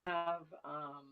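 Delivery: talking through a mouthful of food; chewing while talking. Real, not acted. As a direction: mouth full, words muffled by chewing. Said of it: "I'm chewing".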